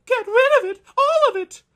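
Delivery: disgusted wife. Acted. Wife recoils from the stew, a high shrieking demand.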